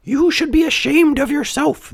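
very high, tiny insect voice; squeaky reproach; scolding cricket. A narrator's reading. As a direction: tell them off as a tiny cricket. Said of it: "the cricket said".

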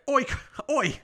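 high, hammed-up Aussie "oi" with a laugh; Australian accent. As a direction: attempt a mock Australian call. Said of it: "In his stupid accent".

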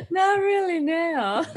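high, giggling "not really, no"; laughing denial. Real, not acted. Laughing off the host's mix-up, giggling through the denial. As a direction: laugh the words out as you deny it. Laughing off knowing [name].

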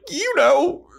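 squeaky high yes; high Buffy voice. Retold or imitated. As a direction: pitch it way up like a sulky teen. Buffy in retold quarrel.

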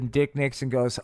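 ordinary talk

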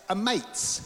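high, playful Aussie accent. Shown as a demonstration. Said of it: "in an Aussie accent".

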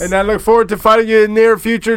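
loud, high fake French accent; hammed-up GSP French accent; mock French accent. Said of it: "G S P is not impressed".

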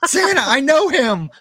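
high, drawn-out gleeful cry; excited recognition. Acted. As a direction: exclaim it like a thrilled fan spotting Santa. Acting out being recognized as Santa.

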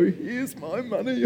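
slow, high little voice, handing over money; timid high squeak as the broke student pays; nervous mock squeak. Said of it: "I'm behind them going".

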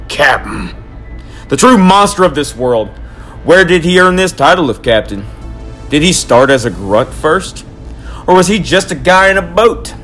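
loud, worked-up tirade; ranting. Acted. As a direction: rant like a crazed conspiracy man. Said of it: "the Lucky Charms rant".

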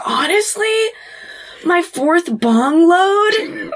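high, slow, wailing confusion; hammed-up high-pitched bafflement; bewildered Andy. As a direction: draw it out as a mock-distressed stoner. Acting dazed Andy's confused answer.

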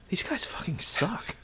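scornful dismissal, drawn out; Luke, disgusted. Retold or imitated. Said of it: "I do also like how he's like".

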